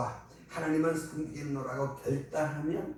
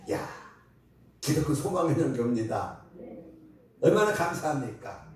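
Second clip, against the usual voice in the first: ordinary talk, nothing marked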